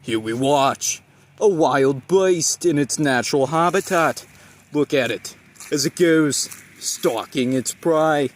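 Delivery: loud, slow nature narrator voice; nature documentary. Acted. Parody wildlife narration in bloopers.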